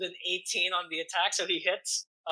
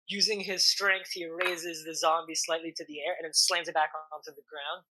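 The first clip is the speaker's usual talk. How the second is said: ordinary talk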